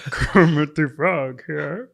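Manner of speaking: slow, stretched-out frog puppet voice; Kermit. Acted. Cohost tries an impression for the host, slow puppet voice. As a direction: draw the words out like the puppet frog. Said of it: "let me try an impression".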